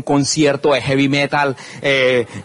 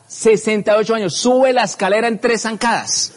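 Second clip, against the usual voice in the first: announcer voice; 6 semitones higher than usual. Retold or imitated. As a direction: sweep the crowd along like an emcee.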